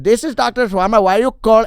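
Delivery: put-on high, loud Indian-accented phone voice; mock Indian accent. Comic posing as the doctor on a grabbed phone, Indian accent. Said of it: "I did the whole accent".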